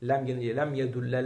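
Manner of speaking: yedulle with a clear final e; ending read as yedulle. Shown as a demonstration. Teacher showing the first of three readings, yedulle. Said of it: "üç şekilde de okunabilir".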